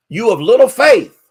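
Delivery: loud, high rebuke; rebuking shout. Acted. Jesus rebuking his frightened disciples, loud shout. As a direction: hurl it at the doubters.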